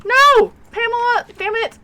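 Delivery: loud, high cry of real frustration at the game; frustrated yell. Real, not acted. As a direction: shout it out, sharp and annoyed, as the plan falls apart. Ghost hunt goes wrong.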